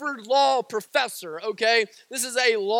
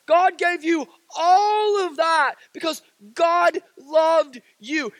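higher, pleading preacher's voice, almost crying; fervent sermon climax, voice near tears; impassioned preaching; 4 semitones higher than usual. Acted. Impassioned climax about God's love.

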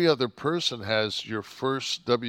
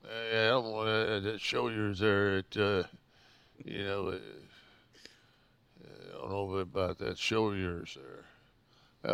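hesitant, subdued mumbling local; mock unsure grumble, trailing and quiet; reluctant Mobile listener; 2 semitones lower, 7 dB quieter than usual. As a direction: imitate a lukewarm fan, soft and halting. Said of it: "You go to fucking Mobile".

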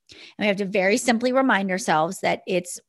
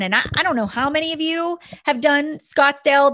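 ordinary delivery

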